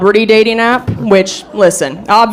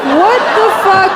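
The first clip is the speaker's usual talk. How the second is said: shocked disbelief; 10 semitones higher than usual. Retold or imitated. Reacting in disbelief.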